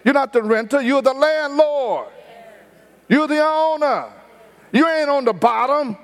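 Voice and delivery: a hint of a proud father drilling his kids; father's exhortation. Father telling his children they are not on the bottom, mildly emphatic. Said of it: "That's what he used to say".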